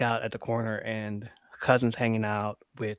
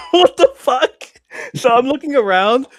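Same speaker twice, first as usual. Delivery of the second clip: loud, high laughing outburst; incredulous laughing yell; laughing disbelief; 13 semitones higher, 14 dB louder than usual. Real, not acted. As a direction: crack up in disbelief.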